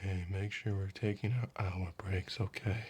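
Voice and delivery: hushed, low murmur right at the ear; soft nag right by the ear; close-in murmur. Retold or imitated. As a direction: lean in and say it softly, close to the ear. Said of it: "comes up to your ear and goes".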